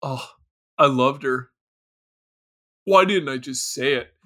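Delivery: low, listless mock regret; lazy acting. Co-host mocking the actor's grief, listless and low. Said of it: "This is the laziest acting".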